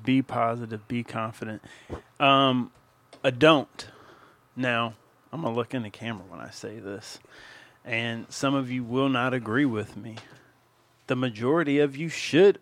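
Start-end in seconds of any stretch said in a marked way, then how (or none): none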